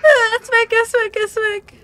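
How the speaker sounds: high and loud, repeating in alarm; panicked. Real, not acted. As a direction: panic as it vanishes. The cross has vanished. (panisch, laut)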